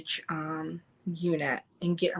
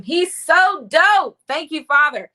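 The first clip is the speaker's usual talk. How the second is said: high, loud, slow swell of feeling; emotional gush; 9 semitones higher, 8 dB louder than usual. Real, not acted. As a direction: let it burst out, near tears of joy. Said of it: "I'm almost wanting to tear up".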